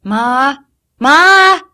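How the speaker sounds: loud calling up to her mother. A narrator's reading. Girl calling her mother downstairs, loud. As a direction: shout for Ma across the house.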